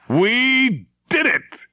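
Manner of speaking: high, slow cry of triumph; radio man exults as the transmitter dies, high and slow; triumphant cry. Acted. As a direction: stretch it out, a jubilant shout.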